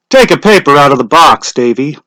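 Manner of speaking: a hint of a village official calling out; lightly voiced lottery official; Mr. Summers' voice. A narrator's reading.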